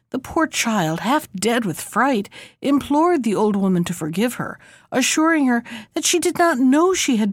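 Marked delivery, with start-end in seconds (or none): none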